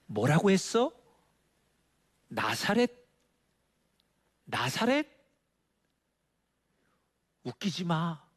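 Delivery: incredulous scoff with rising questions; mock scoffing. Nathanael answers his excited friend Philip, mock scoffing disbelief. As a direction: imitate a sceptic scoffing, pitch jumping high. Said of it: "흥분된 목소리를 비웃기 시작합니다".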